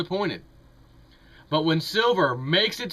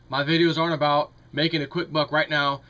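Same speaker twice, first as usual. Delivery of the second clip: ordinary talk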